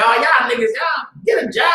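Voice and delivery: high shouted jeer at street preachers; mock shout from the crowd; mocking heckler. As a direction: yell it like a passerby mocking. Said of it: "You say".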